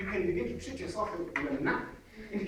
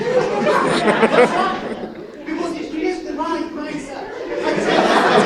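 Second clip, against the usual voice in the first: laughing; 6 semitones higher, 11 dB louder, much slower than usual. Real, not acted. Laughing emphatic point to audience.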